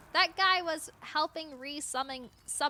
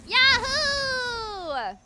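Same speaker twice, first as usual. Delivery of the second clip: high, loud yahoo; triumphant gamer whoop; loud cheer; 11 semitones higher, 9 dB louder than usual. Real, not acted. Cheering during attack.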